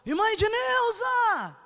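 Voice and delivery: slow, high call of the name; drawn-out calling shout. Retold or imitated. Visitor knocking at the door, calling her name in a drawn-out shout. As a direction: stretch the name out as if through a door. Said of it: "Começou a bater na porta da sala".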